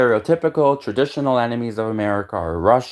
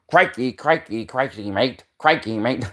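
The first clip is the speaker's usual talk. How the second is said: hammed-up Aussie "crikey" impression; playful caricature of an Australian accent; jokey Australian twang, ending in a laugh; mock Australian accent; slower than usual. Imitating Australians with stock phrase.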